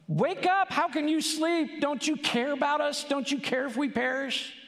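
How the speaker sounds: hammed-up terrified plea; panicked disciples. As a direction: shout like men sure they'll drown. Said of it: "They're like".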